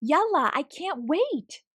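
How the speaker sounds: high, loud, can't wait; excited girl shouting to grandma; eager shout. A narrator's reading. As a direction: call out with glee. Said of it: "Lena shouted".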